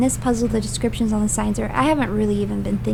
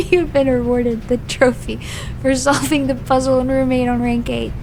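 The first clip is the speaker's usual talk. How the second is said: ordinary talk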